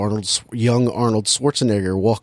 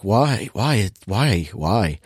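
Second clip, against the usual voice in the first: despairing whys; much slower than usual. Retold or imitated. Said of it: "where you're just like".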